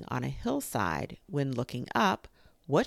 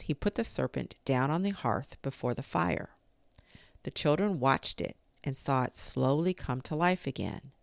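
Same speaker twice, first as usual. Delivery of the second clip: usual speaking voice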